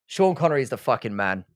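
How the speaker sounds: normal talking voice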